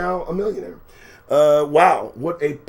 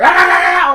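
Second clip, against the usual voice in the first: high, loud sustained scream; mock berserk scream; 14 semitones higher, 12 dB louder than usual. Singer exploding when asked the time, berserk scream.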